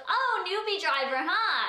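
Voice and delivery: high-pitched sneering imitation; mock rude. Cop teasing a nineteen-year-old new driver, rudely mocking. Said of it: "like a rude, evil".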